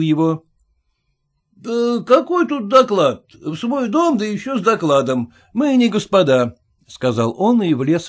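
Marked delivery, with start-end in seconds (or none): 1.5-6.6 a lightly voiced brash merchant, higher; blunt merchant scoffing at formality; gruff merchant. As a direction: push in like the owner. Merchant barges in.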